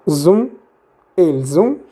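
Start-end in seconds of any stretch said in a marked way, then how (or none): 1.2-1.8 "elles ont" linked by a z sound, el-zon; teacher's model of "elles ont", final s sounded as z; with liaison. Shown as a demonstration. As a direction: join "elles ont" with a clear z. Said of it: "Se hace la liaison".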